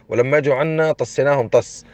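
normal talking voice

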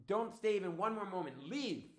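loud, high-pitched angry order; angry screaming. Acted. Woman screaming at Dodi to leave, furious. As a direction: yell them out of the house. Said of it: "started screaming at her and cursing her".

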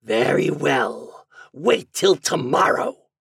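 slow, rising menacing imp; drawn-out threat from a goblin. A narrator's reading. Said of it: "said the imp".

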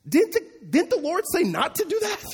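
can you believe this; incredulous. Real, not acted. Pastor asking his congregation in disbelief, incredulous and high.

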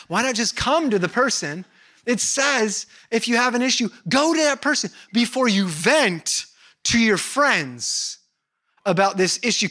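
emphatic preaching, slowed on the command; preaching at full force. Real, not acted. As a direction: push the point home to the congregation.

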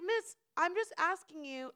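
high-pitched, insistent protest; protesting politeness. Retold or imitated. Storyteller defending a request to the lady, protesting. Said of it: "I'm being mad polite right now".

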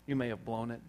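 ordinary talk

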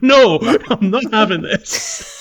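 laughing through a mock refusal. To her mum over peppered chips, laughing. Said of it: "I'm like".

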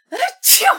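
loud fake sneeze; big high sneeze. Frost boss catches a cold.

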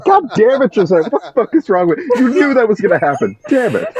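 half-laughing, half-yelling at a friend; laughing exasperation. Real, not acted. Mock outrage at co-host's joke.

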